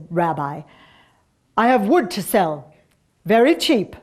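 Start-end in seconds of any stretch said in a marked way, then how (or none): 1.6-4.0 put-on loud Russian-accented peddler. A narrator's reading. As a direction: hawk wares in a Russian accent. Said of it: "in a Russian accent".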